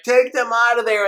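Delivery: high, loud commanding shout; king's order. Retold or imitated. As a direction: bark it like an amazed king giving orders. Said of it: "he's like".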